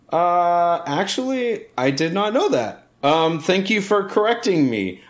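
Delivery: high-pitched, careful mock politeness; sheepish reply. Performer answering the annoyed girl, high-pitched and meekly polite. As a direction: go up high, humbly accepting correction.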